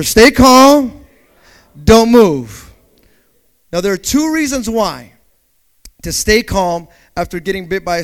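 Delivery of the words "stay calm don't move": shouted call to repeat; congregation call. Pretend. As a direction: belt it out for the church to echo. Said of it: "Tell your neighbor".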